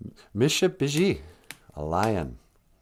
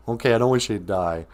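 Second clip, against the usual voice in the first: ordinary delivery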